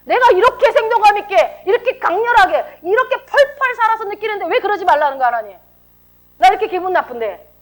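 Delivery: high, loud indignant complaint; indignant protest. Acted. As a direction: protest to God, pitch pushed up. Acts believer protesting to God. (격앙되어 높은 목소리로 항의하듯)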